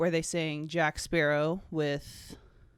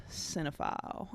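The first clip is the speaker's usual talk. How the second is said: whispered cinephile, hissing the s; 8 dB quieter than usual. Shown as a demonstration. Vlogger hissing her username like a snake, whispered. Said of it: "a little snake on your tongue".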